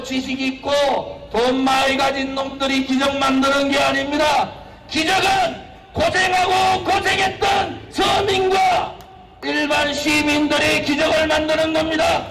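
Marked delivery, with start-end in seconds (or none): 4.9-12.2 high, impassioned rally shouting; roaring rallying speech. Real, not acted. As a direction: preach it to the crowd at full force.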